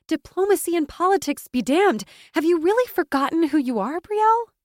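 loud, fierce indignation. A narrator's reading. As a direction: challenge a friend with full heat. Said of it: "Yana said fiercely".

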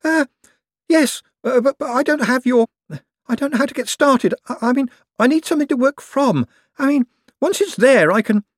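high, halting, flustered voice; stammering Owlswick. A narrator's reading. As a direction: trip over the words nervously.

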